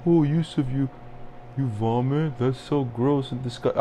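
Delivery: mock horrified woman's voice; mock disgusted date. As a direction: imitate a grossed-out date. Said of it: "it feels weird that I".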